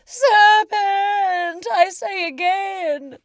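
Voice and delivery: a screeching pigeon voice; shrieking pigeon. Acted. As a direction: hammer the accusation home. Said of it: "repeated the pigeon".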